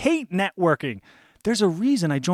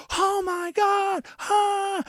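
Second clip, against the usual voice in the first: mock terror; 13 semitones higher, much slower than usual. Normal people reacting to falling.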